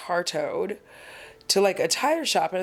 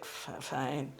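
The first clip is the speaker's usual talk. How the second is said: stammered, much softer grudging fine; sulky fine; 4 semitones lower, 12 dB quieter than usual. Retold or imitated. Vlogger replays her own grudging fine, muttered. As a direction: let the fine trail out small and sour. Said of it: "did you hear the way I was like".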